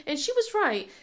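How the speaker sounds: normal talking voice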